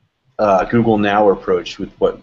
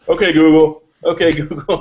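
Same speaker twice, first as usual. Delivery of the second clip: loud call, pitched up; yelling across the room; 6 semitones higher, 5 dB louder than usual. Retold or imitated. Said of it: "people are yelling across the room".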